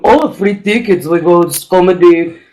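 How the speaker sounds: put-on thick Russian-accented English; mock Russian accent. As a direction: act a Russian audience member. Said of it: "all the Russians who are now".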